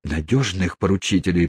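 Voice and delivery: ordinary delivery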